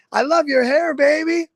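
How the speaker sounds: woman admirer. Retold or imitated. Woman calls out to a stranger, lightly imitated admiring call. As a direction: imitate her with a high, drawn-out compliment. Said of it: "this woman's like".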